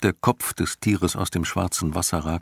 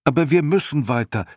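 much higher and louder urgent call; 10 semitones higher, 5 dB louder than usual. A narrator's reading. Boy Atreo calls to his sinking horse, loud and urgent. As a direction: call out to push him onward. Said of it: "rief Atreo".

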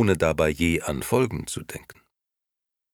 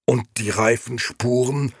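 put-on higher, louder, worked-up old man; agitated; 6 semitones higher, 3 dB louder than usual. Old Beppo confronting Gigi about the tyre tracks, agitated. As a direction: throw the question back at him. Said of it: "fragte Beppo aufgebracht".

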